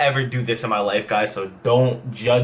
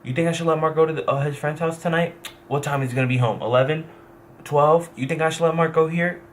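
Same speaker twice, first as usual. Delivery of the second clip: higher, fast, fussy mom voice; mock his overprotective mom asking permission; imitating his mom; 4 semitones higher than usual. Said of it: "my mom used to go to my grandfather".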